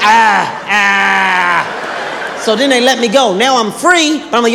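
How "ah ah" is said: startled disgusted cry; grossed-out yell. Retold or imitated. The guy whose palm got licked, a grossed-out yell. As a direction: recoil with a yelled ah ah. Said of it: "He was like".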